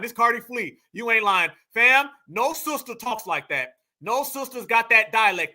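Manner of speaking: ordinary talk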